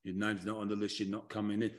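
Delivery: very low, soft Southern accent refusal; Southern accent, low and hushed, blocking the door; Southern accent doorman. Shown as a demonstration. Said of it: "a Southern accent when I do that one".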